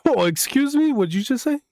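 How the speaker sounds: high, mock-offended "excuse me?"; mock offended surprise. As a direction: act scandalized at the trailer. Reacting to trailer voice.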